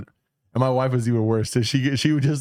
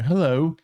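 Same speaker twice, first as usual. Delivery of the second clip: Hello rising up like a question, higher; rising Scottish hello; 6 semitones higher than usual. Retold or imitated. Friend mimics Scots greeting with rising Hello, higher. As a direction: ask Hello as if it were a question. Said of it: "Like, it's a question".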